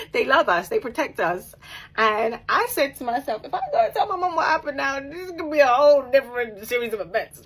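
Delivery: fired up about protective mums; rising, loud emphatic telling; excited. Real, not acted. Heated retelling about protective mothers.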